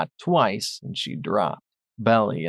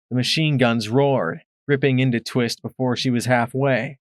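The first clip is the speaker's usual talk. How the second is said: ordinary delivery, nothing marked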